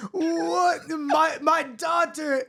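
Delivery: high, slow bad Austrian wail; Arnold Schwarzenegger. Arnold shocked about his daughter, hammed-up Austrian. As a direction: wail it in a botched Austrian accent.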